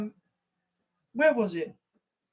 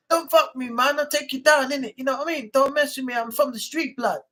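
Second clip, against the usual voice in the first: higher, hammed-up London roadman; mock London street accent; 3 semitones higher than usual. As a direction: threaten like a London street tough. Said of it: "what I said to you".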